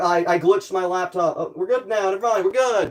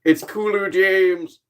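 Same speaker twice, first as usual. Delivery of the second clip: Kulu James voice; 3 semitones higher, much slower than usual. Pretend. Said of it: "He is devious".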